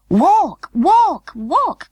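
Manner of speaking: high, loud chorus of dolls; crying out. A narrator's reading. Other dolls cry out to the walking doll, high and loud. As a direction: call it out high and eager, three times. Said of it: "cried the other dolls".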